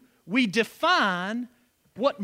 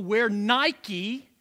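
ordinary talk, nothing marked

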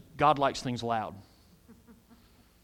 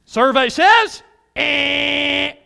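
game show buzzer; 8 semitones higher, 8 dB louder, much slower than usual. Pretend. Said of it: "game show buzzer bit".

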